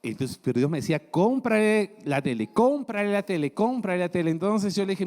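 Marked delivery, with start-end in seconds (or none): 1.1-4.3 raised, insistent repeated command; a booming divine command, repeated; insistent voice of God. Retold or imitated. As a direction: press the order again and again, louder. God insisting in retold story.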